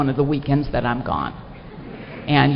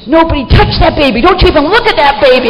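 higher, louder, fast frantic command; frantic order; 8 semitones higher, 4 dB louder than usual. Retold or imitated. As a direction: bark it down the phone like a panicked grandma. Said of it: "And I'm telling Butch".

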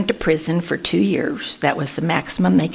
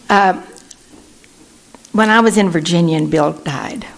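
ordinary delivery

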